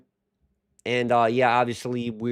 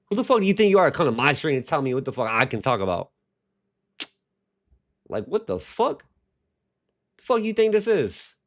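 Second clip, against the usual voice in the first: angry rant; 3 semitones higher than usual. Real, not acted. Streamer snaps at a chat critic.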